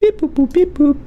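high beeping robot voice. Pretend. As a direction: chirp it like a toy robot. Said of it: "Do I still sound like a robot?".

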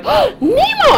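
gasping, high-pitched delight; excited child. Retold or imitated. Child spotting a Finding Nemo doll, thrilled. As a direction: squeal at spotting a favorite toy. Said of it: "I got so excited".

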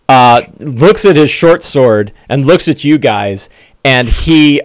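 usual speaking voice

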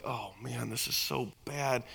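muttered dismay. Retold or imitated. Dad judging son's preaching inside.

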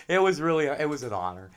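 usual speaking voice

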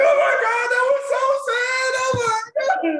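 high, loud wailing mock sob; hammed-up crying man; sobbing friend. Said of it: "he'll be like".

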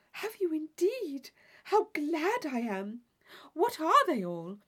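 eager to hear the list; eager Catherine. Acted.

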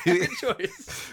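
put-on high laughing Russian accent; squeaky Russian accent. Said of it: "like an Amazon review for somebody from Russia".

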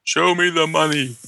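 mock greedy demand, stretched out; show me the money. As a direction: ham up the greedy catchphrase. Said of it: "I say".

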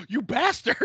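high, gasping exclamation; shocked outburst. Retold or imitated. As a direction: gasp it out in shock. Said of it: "I literally gasped out loud".

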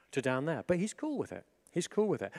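ordinary talk

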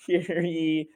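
high, slow herald call; town crier. Retold or imitated. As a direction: proclaim it to the fairgoers. Mock town crier announcement.

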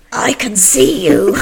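slow, loud, laughing demonic voice. Retold or imitated. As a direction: growl it out like a possessed girl. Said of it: "in the most demonic voice ever".

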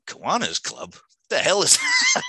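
flat start rising to a high, loud, laughing disbelief; what on earth, with a laugh; baffled inner reaction. Retold or imitated. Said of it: "And I thought".

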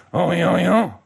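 God mocking the nations with foreign-sounding nonsense; mocking babble. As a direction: mimic their speech as silly babble. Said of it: "you do an impression of it".

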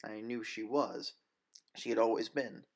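plain reading voice

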